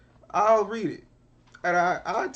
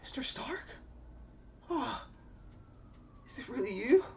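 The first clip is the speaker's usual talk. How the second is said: high-pitched, wide-eyed mock awe; small, high voice of a starstruck young hero; Tom Holland Spider-Man; 10 semitones higher, 6 dB quieter than usual. Spider-Man meeting Doom, high mock awe. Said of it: "Tom Holland Spider-Man is gonna see".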